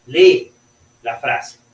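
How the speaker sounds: usual speaking voice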